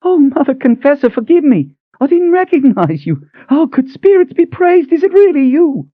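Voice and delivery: put-on high old woman gushing apologies and joy; overwhelmed old cook. Cook kneeling before the Mother Confessor, high gushing joy. Cook begs forgiveness, overjoyed.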